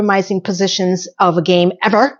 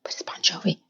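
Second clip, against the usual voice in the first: hushed secret whisper; 13 dB quieter than usual. Acted. Host whispering the song name again. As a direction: drop to a whisper for the joke. Repeats whispered [name] aside.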